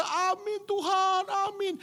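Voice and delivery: very high, long-drawn weeping cry; imitated tearful amen. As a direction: wail the response like a crying worshipper. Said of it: "nangis, berkata". (amin sambil menangis, ditirukan)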